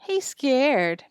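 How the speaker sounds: mildly mocking sneer; sneering taunt. A narrator's reading. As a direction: needle him like a schoolyard tease. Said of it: "Pierre sneered".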